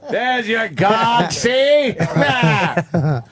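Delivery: high, slow gloating jeer; mocking taunt. [name] taunting Voss again.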